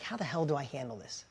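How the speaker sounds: low, hushed question to himself; soft retold helplessness; baffled aside. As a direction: drop right down, at a loss. Dad baffled, asking himself.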